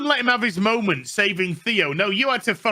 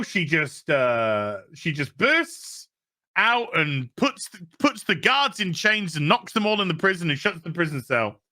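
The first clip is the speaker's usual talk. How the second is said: ordinary talk, nothing marked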